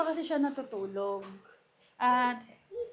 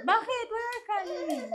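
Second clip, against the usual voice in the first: high-pitched mommy talk; 11 semitones higher, 4 dB louder than usual. Real, not acted. Mother soothing crying baby.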